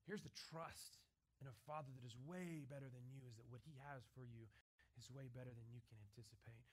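low, soft, intimate sermon voice; hushed preaching. Acted. Preacher softly urging the congregation to be ready. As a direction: draw them in close and quiet.

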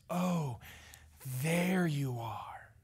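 slow, whispered welcome; hushed, tender greeting to someone entering the room; warm whisper. Retold or imitated. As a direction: breathe it gently, like finding someone dear. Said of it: "somebody else walks in and goes".

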